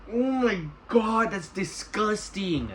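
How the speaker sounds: high, drawn-out put-on revulsion; mock disgust. Viewer watching them gag on dog food, mock disgust. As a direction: stretch it out slowly in high-pitched disgust. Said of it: "They're gagging as they're doing it".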